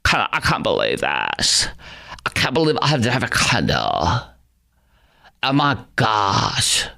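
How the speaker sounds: lightly voiced moaning customer; mock disbelief, dragged out at the end; whining woman impression. Host mimicking woman upset about candles, lightly whiny. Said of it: "you want me to do the impression".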